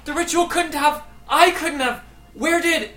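very high, loud, broken-off cries; screaming in disbelief. Acted. Frida reacts to the ritual killing Pinter, screaming in disbelief. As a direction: scream it out, stumbling from phrase to phrase. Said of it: "Frida screams out".

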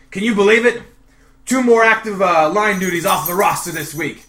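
loud, high, worked-up soldier; agitated private. Acted. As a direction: burst in with bad news, agitated. GM voices an upset private.

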